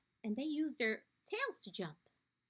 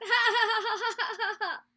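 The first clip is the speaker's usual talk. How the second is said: high, loud mocking ha-ha; mocking laugh; 7 semitones higher, 7 dB louder than usual. Skink laughs at the fallen platypus, mocking. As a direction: cackle at the platypus. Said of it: "simply laughed".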